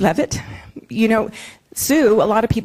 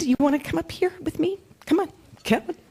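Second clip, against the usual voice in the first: coaxing call; 12 semitones higher, 4 dB quieter than usual. Real, not acted. Coaxing husband up to stage.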